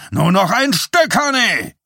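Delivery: high, loud rowdy cheer; bawling. A narrator's reading. Bernard urging on the ticking wheel, rowdy bawling. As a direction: bawl it at the wheel, egging it on. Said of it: "gröhlte auch Steve Bernard".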